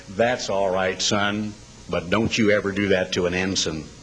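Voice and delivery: a hint of an unruffled admiral; admiral's voice. Admiral's calm reply.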